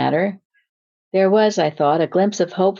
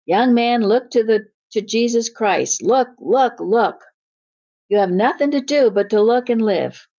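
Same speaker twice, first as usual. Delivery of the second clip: understated revival-preacher's cry, pitched up; mild preacher's shout; 5 semitones higher than usual. Acted. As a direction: read a preacher's shout with restrained fervour. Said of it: "he shouted as only a primitive Methodist could do".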